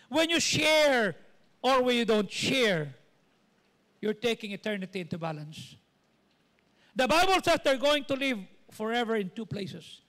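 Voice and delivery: forceful preacher driving the stakes home; full-force preaching. Real, not acted. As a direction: stretch and hammer each key phrase.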